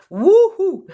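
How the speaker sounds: high, loud joyful whoop; delighted cry of excitement; excited whoop. Real, not acted. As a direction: let out a big happy wouhou. Excited about fire signs.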